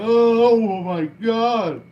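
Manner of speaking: loud, high, slow mock scream. Reviewing the episode, imitating the mannequin's loud drawn-out scream. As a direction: wail it out like the panicking mannequin. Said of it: "screaming around like".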